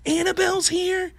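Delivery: put-on high-pitched hopeful child's question; excited little son. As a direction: imitate a small boy perking up in hope. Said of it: "my son jumps up".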